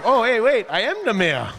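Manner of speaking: sudden high realization, laughing; mock surprise. Mayor realizing he is the mayor, laughing in mock surprise. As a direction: feign dawning shock at his own joke.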